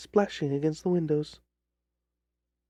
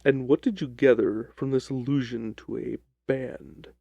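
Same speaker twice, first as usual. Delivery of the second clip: lower, measured detective voice; a calm, lower Holmes cutting in; 5 semitones lower than usual. Acted. Holmes ponders the clue.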